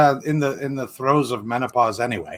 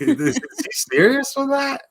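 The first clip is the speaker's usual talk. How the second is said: much higher, slow disbelief; incredulous; 11 semitones higher, slower than usual. Real, not acted. Friend doubts Michael is serious, incredulous and slow. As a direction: ask it like you can't believe it.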